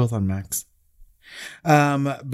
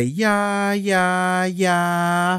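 high, drawn-out mocking yeahs; imitating Robert's yeah yeah yeah; 8 semitones higher, much slower than usual. Listener quoting the podcast to annoy someone, high mock yeahs. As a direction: toss off a teasing high yeah, yeah, yeah. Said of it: "all I do is say".

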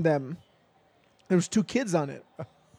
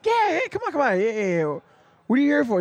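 pushy stranger; 8 semitones higher, faster than usual. Retold or imitated. Stranger insists dad works cannery.

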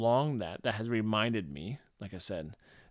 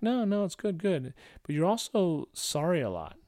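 friend's wife reassuring; 7 semitones higher, 4 dB louder than usual. Retold or imitated. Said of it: "She's like".

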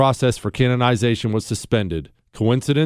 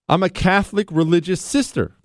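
usual speaking voice

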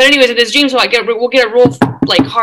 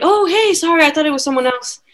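much higher, acted apologetic greeting; sheepish apology; 8 semitones higher than usual. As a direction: backpedal in a high, put-on voice. Acted apology to neighbors at door.

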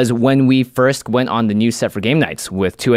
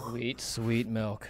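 slow, soft relief; relieved sigh; 2 semitones lower, 15 dB quieter, much slower than usual. Real, not acted. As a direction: savor the relief slowly. Relief drinking milk.

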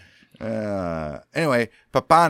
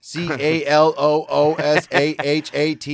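higher, deliberate letter-by-letter spelling; 4 semitones higher, 6 dB louder than usual. Real, not acted. Reader spelling a river's name for co-host, letter by letter. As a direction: spell it aloud, one letter at a time. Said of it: "just spell out everything".

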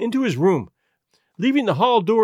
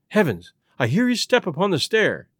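ordinary delivery, nothing marked

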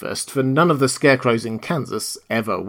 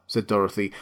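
ordinary delivery

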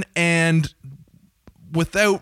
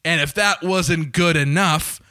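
higher, upbeat sales pitch; sponsor ad read; 4 semitones higher than usual. Acted. Host pitching the sponsor's promo code to listeners, cheery ad voice. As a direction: sell the ticket site with a grin.

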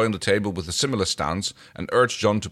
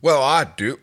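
loud, higher interjection; Rigel character; 6 semitones higher, 6 dB louder, slower than usual. Acted. Rigel speaks up about snatching, loud and high. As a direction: butt in proudly and loudly.

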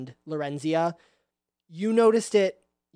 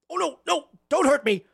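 high, panicky begging; hammed-up cowering plea; frightened pleading; 5 semitones higher, 4 dB louder than usual. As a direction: plead for mercy as a cornered creature. Cornered creature begs for mercy.

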